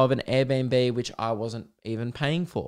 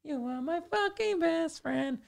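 put-on much higher, slow sloppy drunk voice; drunk teen gushing; slower than usual. Mocking a drunk teenager telling his mate he loves him, high and sloppy. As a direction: slur your love at your mate. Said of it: "and you're like".

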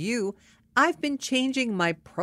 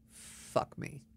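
very soft, voiceless aside; muttered thought; 12 dB quieter than usual. Retold or imitated. Woman thinking to herself after being interrupted, muttering. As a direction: breathe it out under your breath in defeat. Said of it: "I thought to myself".

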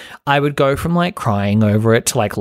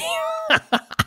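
high squeal of laughter; 5 dB quieter than usual. Real, not acted. Laughing at over-the-top scene.

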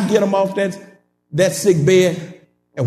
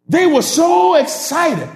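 usual speaking voice

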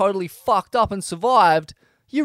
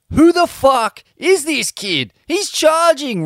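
louder, higher, drawn-out incredulous question; amazed disbelief; 6 semitones higher, 5 dB louder than usual. A narrator's reading. Surfer watching the kid charge big waves, amazed. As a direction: punch it out in acted astonishment.

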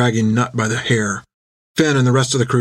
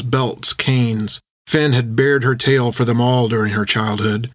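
usual speaking voice

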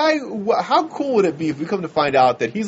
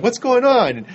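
high startled question; 8 semitones higher, slower than usual. Retold or imitated. Sudden confused outburst.

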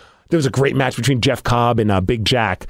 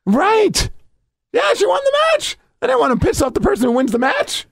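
high-pitched, laughing exclamation; excited. Real, not acted. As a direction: squeal it out in mock panic. Joking about the angry wrestler.

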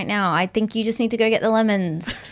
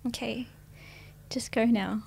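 soft and quiet; 4 dB quieter than usual. Real, not acted. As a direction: speak gently, almost hushed. Tender quiet after the bit.

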